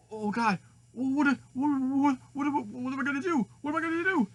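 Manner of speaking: high, stammering panic; frantic high-pitched helplessness; freaking out. Acted. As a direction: stammer it in rising acted panic. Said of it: "he'll be like all freaking out".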